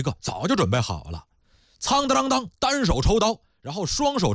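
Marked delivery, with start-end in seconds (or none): 1.8-2.5 high, ringing clang of a drawn sword; high-pitched vocal sword clang; blade-drawing sound. Acted. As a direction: imitate steel sliding out, pitched way up. Narrator voices a drawn blade.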